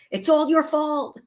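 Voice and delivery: high, accusing jab; teasing accusation; mock blame. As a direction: pin it on her playfully. Blaming sister after loss.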